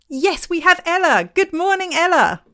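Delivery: high, loud singsong good morning; delighted greeting. Real, not acted. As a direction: sing out a welcome to the guest who made it. Greeting guest who finally connects.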